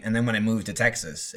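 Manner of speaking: ordinary delivery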